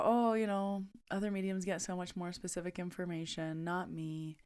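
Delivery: small, quiet voice of retold self-comparison; self-doubting aside. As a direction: drop the volume, imitating your own doubts. Mimicking own earlier self-doubting complaint.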